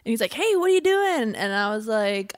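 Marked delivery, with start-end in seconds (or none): 0.3-1.3 high, loud friendly call; imitated eager greeting. Acting friend's cheery FaceTime greeting.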